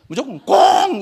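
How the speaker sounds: high, loud, drawn-out exclamation; mock emphatic declaration. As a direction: blurt it out like a blind slogan. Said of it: "이래요".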